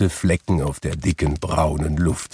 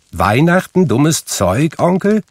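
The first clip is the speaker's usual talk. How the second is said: much higher, rising disbelief; incredulous; 9 semitones higher, 4 dB louder than usual. A narrator's reading. Nephew questioning his grumpy uncle, incredulous. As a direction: laughingly refuse to believe it.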